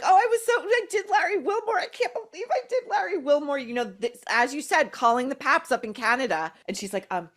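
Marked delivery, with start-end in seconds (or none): 0.0-3.6 high, mocking impression of Meghan. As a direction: squeal it out, much higher, mocking her. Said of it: "And then she's like".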